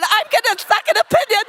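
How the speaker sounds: very high, loud mock wail; hysterical crying, acted. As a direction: peak of a hammed-up hormonal meltdown. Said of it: "I'm hysterical".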